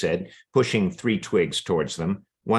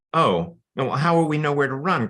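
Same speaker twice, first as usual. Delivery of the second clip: mildly panicked narrated question; worried cry; 7 semitones higher than usual. A narrator's reading. Mice left without the hawk's guidance, worried cry. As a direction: pitch climbing into a higher plea. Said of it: "cried the mice".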